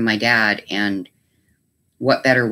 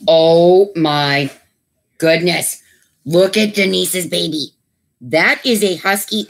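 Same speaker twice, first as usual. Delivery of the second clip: excited; 5 semitones higher, 5 dB louder, slower than usual. Real, not acted. Delighted at puppy photo.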